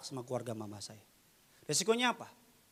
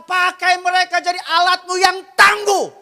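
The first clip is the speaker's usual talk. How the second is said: high, very loud intercession; re-enacted shouted prayer; 20 dB louder than usual. Pastor's wife praying for them to be used, re-enacted as a high shout. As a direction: shout the plea out to God. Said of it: "langsung teriak".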